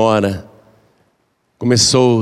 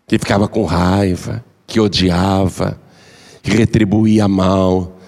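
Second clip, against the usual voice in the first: calm wise man; 3 semitones lower, slower than usual. Acted. The wise man reassures the remorseful daughter-in-law, low and slow. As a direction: soothe her slowly like a wise elder.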